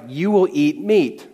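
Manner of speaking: slow, deliberate God voice, lightly done; a hint of a dry, deadpan God; God's flat reply. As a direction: spell it out slowly like God. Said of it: "and God's like".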